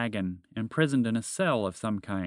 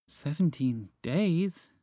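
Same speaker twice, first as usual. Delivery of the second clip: sad; 6 semitones higher, much slower than usual. Acted. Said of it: "she answered with sadness".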